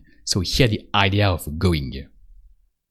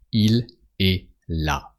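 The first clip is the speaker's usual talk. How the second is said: slow, each word set apart; slow and careful; 3 dB quieter, slower than usual. Shown as a demonstration. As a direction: spell it out slowly for the learner. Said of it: "you can say it a bit faster".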